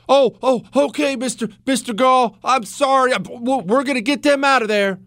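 high, flustered grovelling; hammed-up timid backing down; mock-panicked apology. Mock consul caving to Gaul.

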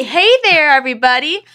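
cheery greeting. Shown as a demonstration. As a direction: sing out a chipper welcome. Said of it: "I'm gonna say".

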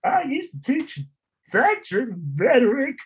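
high-pitched, drawn-out caricature of the co-host; exaggerated high impression, word by word; Joe voice. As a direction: mimic your co-host in a slow, squeaky put-on voice. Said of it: "gonna do this in a Joe voice".

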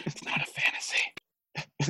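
slow unvoiced ad whisper; whispering commercial. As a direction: draw it out in a whisper. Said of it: "more whispering".

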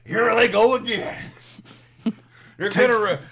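hammed-up wrestler rant; Dusty Rhodes impression. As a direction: cut a promo like Dusty Rhodes. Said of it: "your Dusty Rhodes was your best impression".

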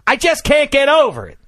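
high, loud burst of exasperation; flustered, incredulous outburst. Real, not acted. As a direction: blurt it out high, still worked up. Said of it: "I'm sorry, I'm flustered".

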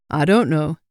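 put-on elderly countryman with a northern English accent. A narrator's reading. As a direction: warn them in a northern English voice. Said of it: "with an accent from further north".

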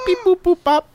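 high beeping robot voice; robot voice making computer beeps. Pretend. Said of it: "it's gonna be noises with my mouth".

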